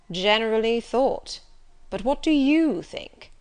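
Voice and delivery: loud, slow, pointed challenge; challenging. A narrator's reading. Master presses the governess for her own opinion, challenging. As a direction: throw it back at them, pressing.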